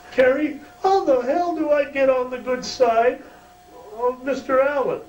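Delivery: very high, thin pleading voice; Carl's high voice. Retold or imitated. Impersonating Carl asking Kerry for advice, very high. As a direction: squeak it up like a meek young guy. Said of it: "he almost talked like this".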